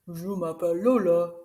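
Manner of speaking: lightly voiced second puppet; a different puppet voice. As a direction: answer as the other toy.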